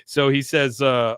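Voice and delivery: ordinary talk, nothing marked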